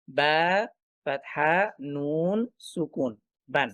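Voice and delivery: "বা ফাতহা নুন সুকুন" drawn out slowly, then "বান"; spelling out tanween. Shown as a demonstration. Teacher showing how written tanween is pronounced, slowly spelled out. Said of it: "তখন উচ্চারণ করছি কিন্তু এভাবে".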